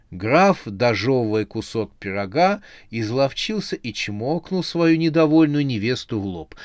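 ordinary delivery

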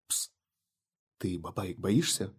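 slow, soft secretive whisper; conspiratorial whisper. Acted. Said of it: "заговорщицки прошептала Соня".

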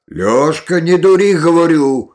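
loud cry rising in pitch; alarmed shout. A narrator's reading. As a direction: shout a desperate warning to stop. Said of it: "уже вскричал дед".

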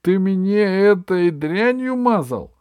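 high, slow horrified question. Acted. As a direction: dread rising in the voice. Horrified accusation.

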